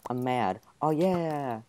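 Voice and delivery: usual speaking voice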